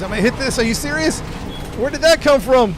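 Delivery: sudden high alarmed yelps; shocked high cries as something comes out of nowhere; startled. Real, not acted. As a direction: caught off guard, voice jumping up. Sudden danger in the game.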